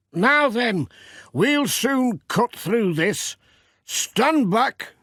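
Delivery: high, slow, hearty country farmer; cheerful workman warning the postman back; Peter's voice. Acted. Farmer telling Pat to stand back, high and hearty. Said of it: "he said".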